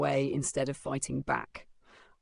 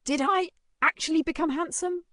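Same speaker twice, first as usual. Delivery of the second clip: disbelief; 13 semitones higher, 4 dB louder than usual. Acted. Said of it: "muttered in disbelief".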